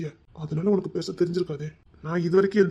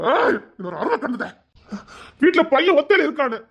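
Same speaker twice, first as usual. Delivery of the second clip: angry shout; 6 semitones higher, 5 dB louder than usual. Acted. Angry outburst about the boy.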